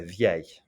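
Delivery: "vieille" closing on a Y glide, no L; same-sound demonstration. Shown as a demonstration. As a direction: say masculine "vieille" as vyay, silent L. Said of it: "gonna be pronounced the same way".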